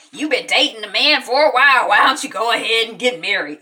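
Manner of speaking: hammed-up parental quarrel; mock arguing father. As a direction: imitate a dad arguing loudly. Acting out father in argument.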